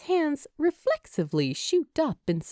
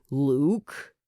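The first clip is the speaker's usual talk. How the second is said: warning; 6 semitones lower than usual. A narrator's reading. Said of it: "Alex warns knowingly".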